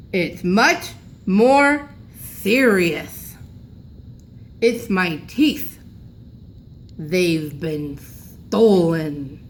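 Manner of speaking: put-on very slow, mumbled old man without his teeth; toothless grandpa. A narrator's reading. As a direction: talk gravely as if your teeth are gone. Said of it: "Why is Grandpa talking funny?".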